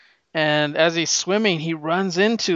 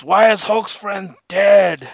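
slow, loud, higher Hulk voice; 5 semitones higher, 6 dB louder, slower than usual. Retold or imitated. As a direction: boom it out slowly like a confused Hulk. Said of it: "he turns to the Hulk, and it's".

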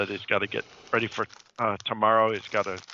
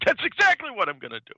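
high, louder retold threat; mock angry stepfather; 11 semitones higher, 5 dB louder, faster than usual. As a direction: imitate a fed-up stepfather raising his voice.

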